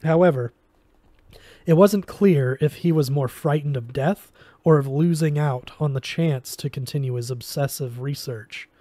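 ordinary delivery, nothing marked

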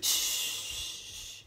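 drawn-out "shh"; long hushing shush. Acted. Silencing someone about to propose, long shush. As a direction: hush them firmly with a long hiss.

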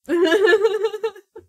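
high, loud cackling laugh; helpless laughter; amused laughing fit. Real, not acted. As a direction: crack up in one long run. Cat stood back up.

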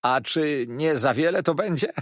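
playful waiter, high and strong; amused waiter. A narrator's reading. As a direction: tease the guest with a lifted, lively question. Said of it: "roześmiał się".

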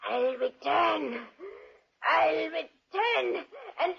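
slow, with a dying laugh and cry; gasping threat as she dies; dying curse. Acted. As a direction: promise revenge with the last breath. Elsa dies cursing them.

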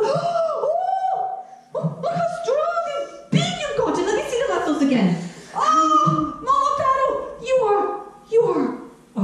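lightly laughing high delight. Acted. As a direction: coo up high in amazement. Grandma marvels at grown boy.

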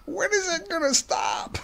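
high-pitched comic whine of a fed-up husband; mock exasperation. Husband imitating himself fed up with his wife's TikToks, exasperated.

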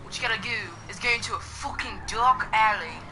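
playful put-on English accent. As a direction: chat along in a jokey English accent. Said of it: "I'm just gonna talk in this English voice".

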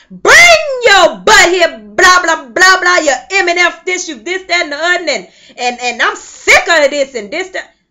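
loud, high, hammed-up yelling at the children; mock yelling mother. Preacher mimicking a mother yelling at her children in the store, mock shout. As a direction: play the big-mouthed woman cussing her kids. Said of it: "in the grocery store with a big mouth".

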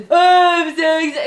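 loud, mocking whiny "oh, I'm so exhausted"; mocking whine. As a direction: mimic a tired wife to tease her. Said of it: "He started making fun of me".

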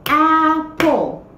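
Apple split into two claps, ap-ple; clapping syllables. Shown as a demonstration. Teacher clapping the syllables of apple for kids. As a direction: clap it out, ap-ple. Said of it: "Let's try".

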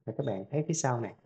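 ordinary delivery

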